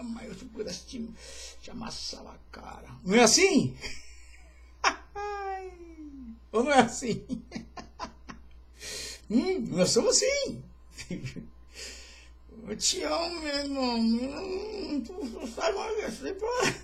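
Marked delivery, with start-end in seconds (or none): 0.0-2.9 softer, breathy whisper of the real thought; whispered aside. Pretend. Pastor mocks a fake brother, whispered aside. Confessing hidden hostility aside. 12.7-16.7 higher, whiny mock-tearful hypocrite; hammed-up fake affection. Pastor mimics a hypocrite to the church, mock-tearful whine.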